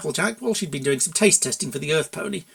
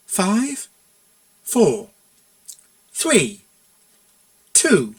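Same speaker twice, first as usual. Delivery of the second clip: slow countdown; 4 semitones higher, much slower than usual. A narrator's reading. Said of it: "slowly and deliberately".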